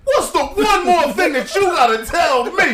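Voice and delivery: high, slow mock exasperation; exasperated victim. Prank victim answering a stalling caller, mock exasperated. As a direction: drag the question out, acting fed up.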